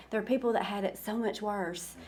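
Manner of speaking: usual speaking voice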